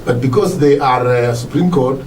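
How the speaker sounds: usual speaking voice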